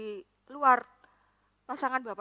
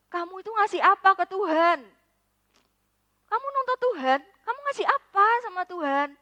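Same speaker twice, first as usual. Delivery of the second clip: rising, louder, higher scolding question; indignant rebuke; 7 semitones higher, 8 dB louder than usual. Retold or imitated. Preacher rebuking his friend, loud and indignant. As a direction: scold her for demanding from God.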